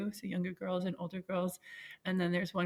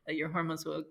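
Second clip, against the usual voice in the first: ordinary talk, nothing marked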